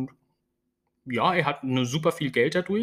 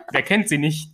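ordinary delivery

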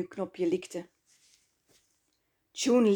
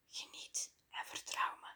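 breathy whisper close to her ear; 18 dB quieter than usual. A narrator's reading. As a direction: whisper it as a secret promise. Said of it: "fluisterde June".

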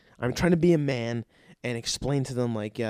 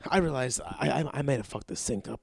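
usual speaking voice

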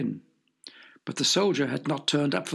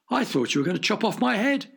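gasped; 6 semitones higher than usual. Acted. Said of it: "gasped Horatio".

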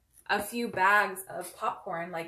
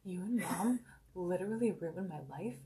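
quiet deadpan; 3 semitones lower, 10 dB quieter than usual. Retold or imitated. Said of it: "the way that she says it".

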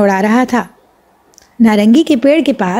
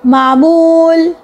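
higher, slow drawn-out answer; a girl playing the magician's subject, slow and higher; girl in a trance; 6 semitones higher, much slower than usual. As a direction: answer like a dazed hypnotised girl. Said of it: "سیما بولی".

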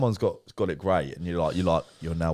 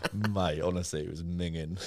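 ordinary talk

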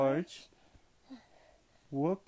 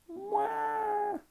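mock baby wail; 5 dB quieter than usual. A narrator's reading. George cries for dinosaur.

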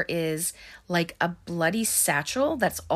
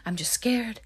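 imitating a frightened whisper, a little higher; scared whisper; 4 semitones higher than usual. Heather whispers to Mike that she's only scared. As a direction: breathe it out, shaken and scared. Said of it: "she's still crying".

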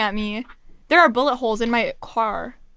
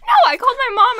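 normal talking voice

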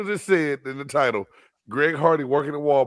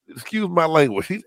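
ordinary talk

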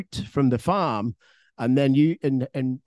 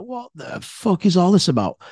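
higher, emphatic retold protest; indignant disbelief; 5 semitones higher, 4 dB louder than usual. Man ordered out of the car by police, indignant disbelief. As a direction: re-enact being baffled and affronted.

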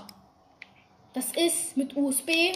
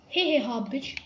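ordinary talk, nothing marked

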